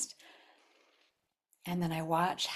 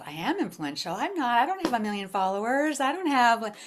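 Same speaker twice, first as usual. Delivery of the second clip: higher and louder, mock insecure whine; 7 semitones higher, 8 dB louder than usual. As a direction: play up a doubting self, louder and higher. Mocking an insecure self-description.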